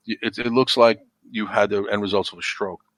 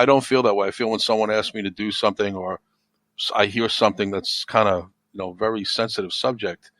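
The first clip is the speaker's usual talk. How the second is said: normal talking voice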